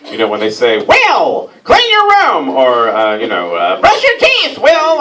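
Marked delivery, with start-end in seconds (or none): 0.8-2.5 bossy parent. Retold or imitated. Host mimicking a bossy parent to Will. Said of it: "adult is being as bossy as they can be". 3.8-5.0 bossy parent. Retold or imitated. Host mimicking a bossy parent to Will. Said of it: "adult is being as bossy as they can be".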